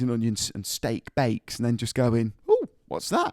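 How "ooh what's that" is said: high, prying mock voice; a nosy peek, pitched way up; mock nosy ooh. Mock Greggs staff ogling customer.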